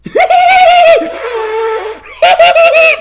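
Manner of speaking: loud, high cackling laugh at the audience; big mocking laugh. Real, not acted. Mocking people who like the movie, loud cackle.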